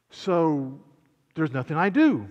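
acted challenge, punched on DO; questioning listener. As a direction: play a listener checking, leaning on "do". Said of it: "somebody doesn't sit up and say".

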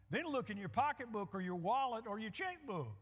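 ordinary talk

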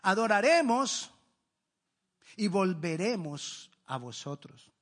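slow down and lean on every word of Adoraremos y volveremos; slow, stressed quote. Shown as a demonstration. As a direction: spell out Abraham's faith slowly, word by word. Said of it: "Pero mire lo que él dice".

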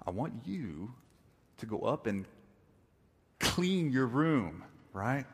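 slow, deliberate, pausing between phrases; emphatic parental repetition. Acted. Dad repeating order to child, slow and emphatic. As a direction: spell the order out slowly, leaving no misunderstanding. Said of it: "doesn't want to be misunderstood".

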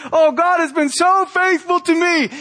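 high, beaming churchgoer testifying; mock the glowing testimony, pitched high; gushing testimony voice. Preacher mimics a churchgoer at the mic, high and gushing. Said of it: "Let's get 'em the mic".

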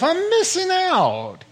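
hammed-up longing son; restless son. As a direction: play the discontented son yearning. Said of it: "He thinks to himself".

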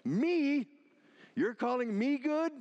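"Me?" and "me" punched high and loud, incredulous; emphatic me. Retold or imitated. Jesus throwing the word back at the rich man, stressing "me". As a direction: lean hard on "me", as if offended. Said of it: "the me is emphatic".

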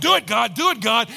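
high, loud cheering-on; mock urgent urging. Preacher watching the storm radar, urgently egging God on. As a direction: urge it on insistently, playfully.

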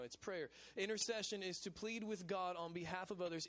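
ordinary talk

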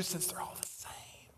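breathy whispered aside, no voice at all. Retold or imitated. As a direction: drop to a mocking whisper. Mocking tolerant view aside.

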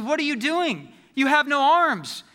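stunned, pointing out the obvious; exasperated Arthur. Retold or imitated. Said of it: "And Arthur says".

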